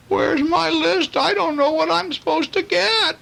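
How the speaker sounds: panicked little pig. Acted. Truffles at the store, lost his list, high fretting.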